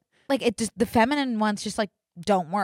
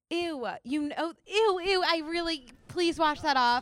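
high, squealing ew; disgusted; 9 semitones higher than usual. Real, not acted. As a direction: recoil in disgust. Disgusted by face paint.

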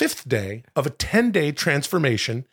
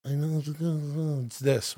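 very soft mumbled Brando; hushed Godfather murmur; Brando mumble; 10 dB quieter than usual. Retold or imitated. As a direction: murmur under the breath like the Godfather. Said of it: "Marlon Brando".

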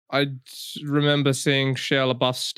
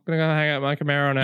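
fast casual; faster than usual. Retold or imitated. Acting Sam scene.

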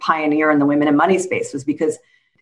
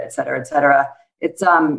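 usual speaking voice

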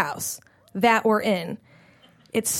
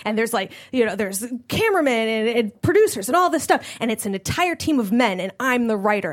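ordinary talk

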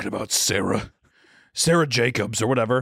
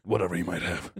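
low menacing Walter; 6 semitones lower, 6 dB quieter than usual. Retold or imitated. Walter correcting himself.

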